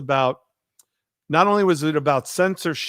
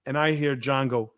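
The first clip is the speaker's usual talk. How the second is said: ordinary talk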